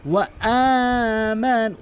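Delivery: slow, drawn-out chanted recitation; Quran recitation. Shown as a demonstration. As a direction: recite with long stretched vowels. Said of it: "এক আলিফ টেনে". (ধীর টেনে টেনে কুরআন তিলাওয়াত)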